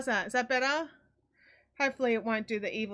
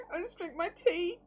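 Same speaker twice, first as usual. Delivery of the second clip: ordinary talk, nothing marked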